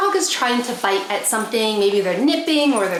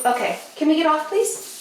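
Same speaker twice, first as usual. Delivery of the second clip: high, pleading ask to the dogs, rising much higher on please; 7 semitones higher than usual. Real, not acted. Owner coaxing her dogs off the bed, high and pleading. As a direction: sweet-talk them off the bed, pitched way up.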